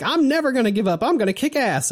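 high, quick mock determination; lightly hammed-up brave girl; fired-up girl imitation. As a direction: pump yourself up like a plucky heroine. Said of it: "and Megumi's like".

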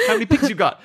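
lightly mock the neighbour; taunting thief. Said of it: "he was like".

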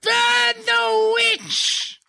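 high, slow, drawn-out mob chant; rally the crowd to burn the witch; angry mob leader. Acted.

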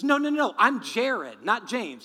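loud, high, emphatic correcting; insistent correction. Retold or imitated. Victim corrects the mugger about their names, insistent correction.

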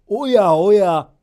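put-on old man shocked by the baby; startled. A narrator's reading. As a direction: gasp it out loudly. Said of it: "びっくりして".

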